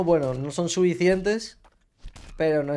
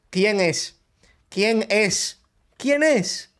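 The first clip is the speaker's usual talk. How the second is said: each repeat climbs higher, the last much higher; escalating angry demand; 6 semitones higher, much slower than usual. Real, not acted. Repeated demand, rising each time.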